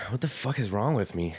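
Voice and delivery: normal talking voice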